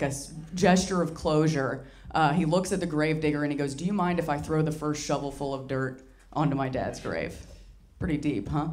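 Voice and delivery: ordinary talk, nothing marked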